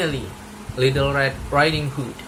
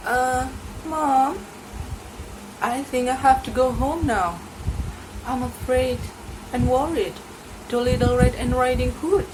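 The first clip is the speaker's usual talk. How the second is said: higher, anxious mother's voice; a mother fretting about her child; worried mother; 12 semitones higher than usual. Acted.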